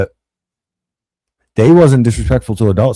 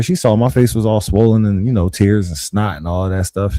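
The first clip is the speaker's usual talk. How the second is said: ordinary delivery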